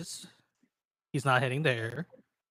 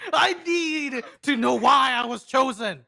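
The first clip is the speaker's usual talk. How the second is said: higher, pleading insistence; insistent demand; 6 semitones higher, 3 dB louder than usual. Acted. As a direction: demand an answer, pitch raised. Player acts demanding an answer.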